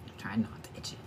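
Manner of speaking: breathy near-silent whisper. Real, not acted. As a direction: whisper it to yourself. Repeats line as whisper.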